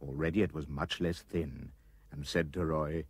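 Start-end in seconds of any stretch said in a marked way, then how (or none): none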